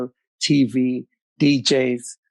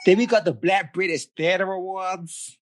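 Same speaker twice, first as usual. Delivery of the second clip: put-on American accent; British speaker having a go at American; 3 semitones higher than usual. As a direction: do your best American accent. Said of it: "in your best American accent".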